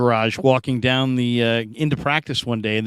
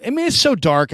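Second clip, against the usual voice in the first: ordinary talk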